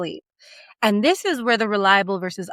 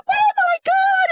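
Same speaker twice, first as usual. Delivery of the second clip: terrified; slower than usual. Acted. Narrator's terrified exclamation acted.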